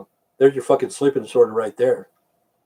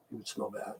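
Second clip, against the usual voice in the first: whisper; 4 semitones lower, 23 dB quieter than usual. Real, not acted. Trails to whisper.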